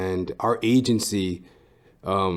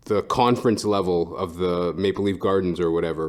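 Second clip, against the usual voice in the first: ordinary delivery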